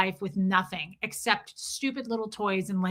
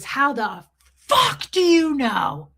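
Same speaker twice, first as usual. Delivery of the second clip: higher, louder, drawn-out mock scream; retold scream; 4 semitones higher, 6 dB louder, slower than usual. Lady berating a mother at a baby shower, imitated screaming. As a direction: imitate her outburst, stretching it out loud and high. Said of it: "Literally screams it".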